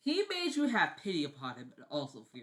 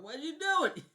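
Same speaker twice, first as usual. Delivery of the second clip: ordinary delivery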